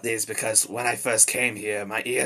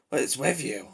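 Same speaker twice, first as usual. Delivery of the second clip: irritated challenge; 2 semitones higher, 3 dB quieter than usual. Acted. First, milder irritated challenge.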